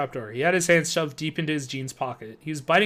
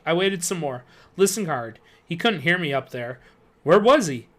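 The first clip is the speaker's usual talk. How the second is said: plain reading voice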